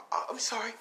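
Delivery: put-on high, stammered little boy apology; a startled child saying sorry fast; timid boy apology. Boy bumps into stranger.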